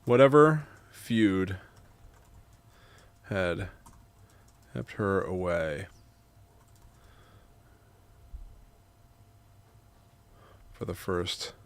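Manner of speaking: measured, soft reading with long pauses; memoir narration. A narrator's reading. Writer narrating grandma's visit, measured memoir reading. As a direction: read it quietly, phrase by phrase. Reads own memoir aloud in takes.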